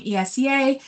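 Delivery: ordinary talk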